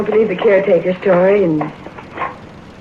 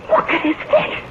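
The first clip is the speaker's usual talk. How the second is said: higher, strained, fearful repeat; terrified plea; 10 semitones higher, 4 dB quieter than usual. Acted. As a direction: push it up into panic. Wife repeats, rising terror.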